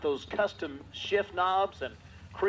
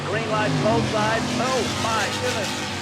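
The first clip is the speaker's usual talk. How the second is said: excited; 3 semitones higher, 6 dB louder than usual. Real, not acted. Race launch, announcer erupts.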